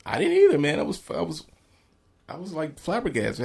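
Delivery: amazed. Real, not acted. Amazed at discovery.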